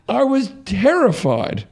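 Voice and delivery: ordinary talk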